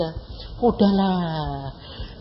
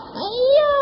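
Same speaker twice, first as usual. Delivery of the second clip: eager caller; 3 dB louder than usual. Retold or imitated. Voicing the caller agreeing.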